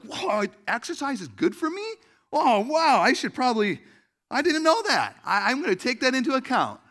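high, loud sarcastic astonishment; mock amazement. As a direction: gush as if this obvious advice were breaking news. Mock amazement at obvious advice.

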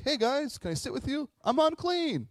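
high, loud announcement, hammed up; over-eager newcomer. Outcast greeting junior high lunchroom, over-eager. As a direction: pipe up far too brightly, ending in a shout.

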